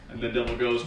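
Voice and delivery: ordinary delivery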